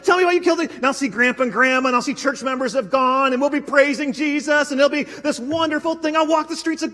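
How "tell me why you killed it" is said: eager question. Pretend.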